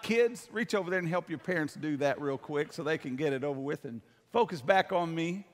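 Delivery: ordinary talk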